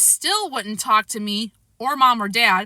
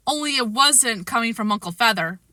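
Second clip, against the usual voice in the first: plain reading voice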